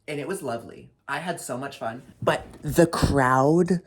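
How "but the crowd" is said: slow, loud furious rant; slow outraged yelling about the crowd. Real, not acted. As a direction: hammer each word out in outrage. Ranting about drunk crowd member.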